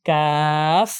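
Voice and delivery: long "কাফ" closing on f; letter-name demo. Shown as a demonstration. Teacher points out the final letter, drawn-out pronunciation. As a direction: stretch "কাফ" and let the f sound. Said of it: "এর শেষে একটা ফা আছে".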